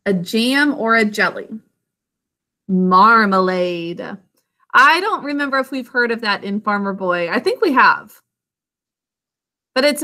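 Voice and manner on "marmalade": slow model word. Shown as a demonstration. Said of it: "Can you say marmalade?".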